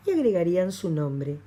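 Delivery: normal talking voice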